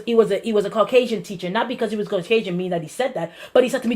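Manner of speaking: ordinary talk, nothing marked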